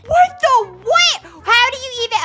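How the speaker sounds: high, loud disbelief; can't believe your eyes; shocked. Real, not acted. Shocked at blood puddles.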